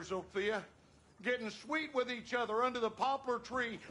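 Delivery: ordinary talk, nothing marked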